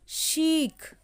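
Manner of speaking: long ee in शीख held out; teacher modelling शीख with a long ee. Shown as a demonstration. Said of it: "बड़ी ई का जो sound होता है".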